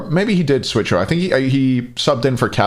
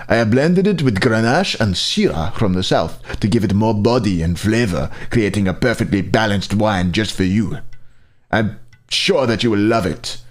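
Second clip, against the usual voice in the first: put-on reading the wine label in a light French accent; a gentle put-on French accent, warm and proud. Streamer reads a winemaker's letter to chat, French accent. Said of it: "That was not a French accent".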